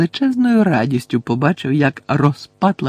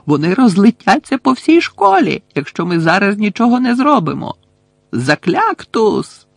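alarmed shrieking; 8 semitones higher than usual. A narrator's reading. Umbridge warns of fireworks spreading through school, shrieking. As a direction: cry out in high-pitched alarm. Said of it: "зарепетувала Амбридж".

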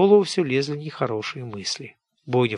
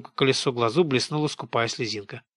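ordinary delivery

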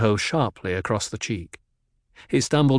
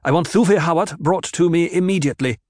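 louder, fast clipped command; snapped order; 7 semitones higher, 5 dB louder, faster than usual. A narrator's reading. Jessica demanding Hawat from the doctor, snapping fast. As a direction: snap it out impatiently. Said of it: "she snapped".

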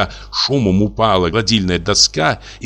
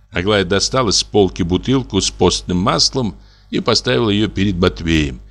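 plain reading voice

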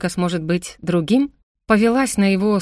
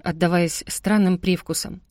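plain reading voice

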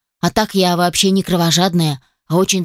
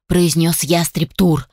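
usual speaking voice

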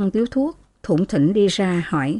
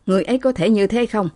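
plain reading voice

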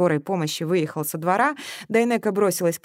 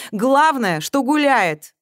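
loud, raised, menacing retort; angry outburst; 7 semitones higher, 7 dB louder, much slower than usual. A narrator's reading. Man closes in on the girl, angry. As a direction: snap it out forcefully.

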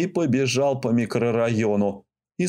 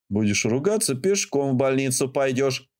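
usual speaking voice

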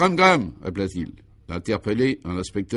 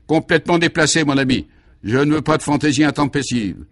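stern scolding; 5 semitones higher, 5 dB louder than usual. Acted. Commissaire scolds an inspector.

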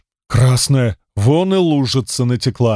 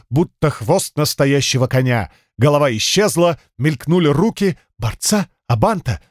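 plain reading voice

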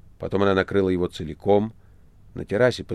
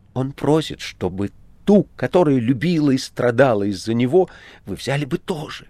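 higher, imploring plea; pleading; 6 semitones higher, 4 dB louder than usual. A narrator's reading. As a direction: beg him earnestly. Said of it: "моляще обратился". (моляще, с надеждой)

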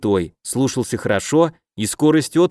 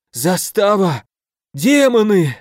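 wind knocked out; 6 semitones higher, slower than usual. A narrator's reading. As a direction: force words out between gasps. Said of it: "хватая ртом воздух, выдавил из себя". (задыхаясь)

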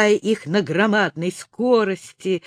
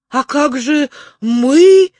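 loud, drawn-out higher call; shouted; 6 semitones higher, 7 dB louder, slower than usual. A narrator's reading. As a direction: call after someone flying away. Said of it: "закричал Рекс".

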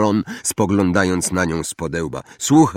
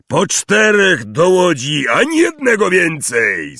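called out; 9 semitones higher, 6 dB louder than usual. A narrator's reading. Hagrid sending pupils into the boats, calling loudly. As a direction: holler instructions across the shore. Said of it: "zawołał Hagrid".

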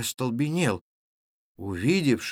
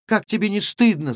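raised, higher-pitched scolding; reproach; 8 semitones higher, 4 dB louder than usual. A narrator's reading. Ганна to the tall stranger after his talk, reproachful. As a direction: shame him with a lifted voice.

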